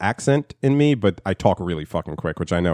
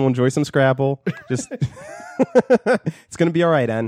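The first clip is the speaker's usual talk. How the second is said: mock grandma; 6 semitones higher than usual. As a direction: coo it reassuringly, pitched up.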